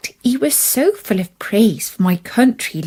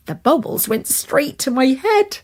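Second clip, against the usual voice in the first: usual speaking voice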